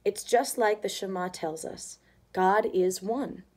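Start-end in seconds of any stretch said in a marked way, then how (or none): none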